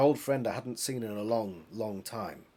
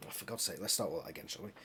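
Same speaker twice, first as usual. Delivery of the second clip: plain reading voice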